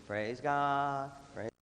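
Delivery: lightly voiced slow choir praise; a hint of a drawn-out gospel singer; mock choir. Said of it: "I'm not even sure I can do it".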